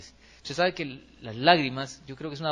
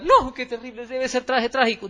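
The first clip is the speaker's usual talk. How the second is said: higher, louder hammed-up panic; mock alarmed worrier; 7 semitones higher, 4 dB louder than usual. Preacher imitating an anxious person expecting disaster, hammed-up and higher. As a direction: play the catastrophizer gasping at bad news. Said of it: "siempre pensando".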